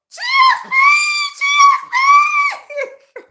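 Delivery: high screaming call at the screen; screaming a name. Retold or imitated. Fan shrieking at the TV on seeing a commenter. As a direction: shriek the name in delighted shock. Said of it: "I screamed at the screen".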